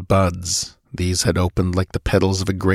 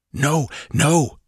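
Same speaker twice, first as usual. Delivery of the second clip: gasping; 6 semitones higher than usual. Acted. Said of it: "gasped Nabonidus".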